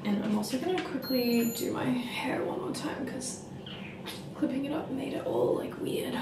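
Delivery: soft whisper. Real, not acted. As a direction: whisper it, getting ready at night. Whispers while getting ready.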